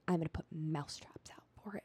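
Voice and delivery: hushed, breathy low aside; whispered mom quote. Retold or imitated. Child recalling mom about the mouse, whispered. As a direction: murmur it like a secret. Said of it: "And Mom was like".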